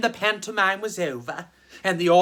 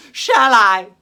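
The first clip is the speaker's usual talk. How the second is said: much louder playful repeat to the crowd; louder, coaxing the audience; loud teasing call; 3 semitones higher, 11 dB louder than usual. Acted. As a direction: tease them with a big loud shall I. Teasing the audience before opening.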